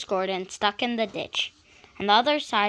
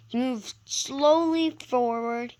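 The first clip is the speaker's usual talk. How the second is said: usual speaking voice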